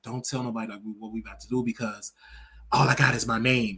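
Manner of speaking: imitated hushed, low-pitched pleading; retold nervous plea. Singer begging his date to tell nobody, imitated low and nervous. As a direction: quietly act out someone begging for secrecy. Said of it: "he got really nervous".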